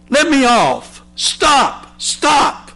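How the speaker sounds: begging from the wheel, voice climbing high; screaming. Acted. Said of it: "I screamed".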